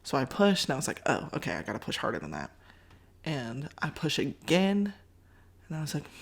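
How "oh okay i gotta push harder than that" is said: low quick self-talk. Retold or imitated. Realizing after weak push.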